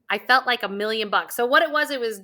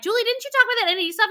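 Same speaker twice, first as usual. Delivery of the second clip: high, fast, excited imitation of people asking; light mock-excited crowd voice; eager questioners; 11 semitones higher, much faster than usual. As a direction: rattle it off like a curious fan. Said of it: "everybody's been like".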